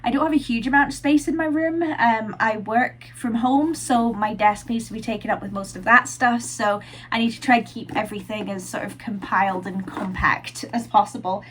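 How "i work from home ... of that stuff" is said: ordinary talk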